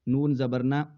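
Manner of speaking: ordinary delivery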